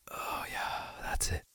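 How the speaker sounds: breathy intimate whisper. Acted. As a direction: drop into a close whisper.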